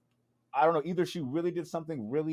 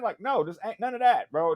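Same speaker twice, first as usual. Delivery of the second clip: usual speaking voice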